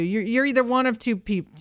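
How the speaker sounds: normal talking voice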